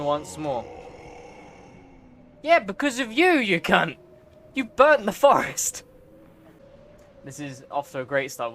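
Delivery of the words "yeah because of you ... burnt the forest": a higher, louder, outraged yell; angry yell. Real, not acted. Player yelling at the creature who burnt the forest, angry. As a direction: rage at the villain on screen.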